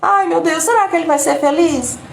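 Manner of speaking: mock the person who always finds a reason to suffer; hammed-up fretful sigh of a perpetual victim; whiny self-pitying lament. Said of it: "é aquela pessoa que".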